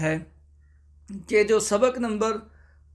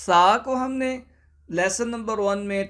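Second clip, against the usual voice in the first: ordinary delivery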